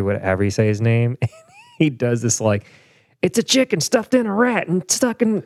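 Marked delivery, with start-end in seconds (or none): 3.2-5.4 high, excited comic TV-host impression; Bobby Moynihan doing Guy Fieri, pitched way up. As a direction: rattle off the dish in a high put-on voice. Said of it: "Bobby Moynihan does Guy Fieri".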